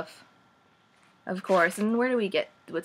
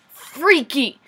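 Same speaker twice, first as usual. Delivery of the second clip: louder freaky; 6 semitones higher, 8 dB louder than usual. Real, not acted.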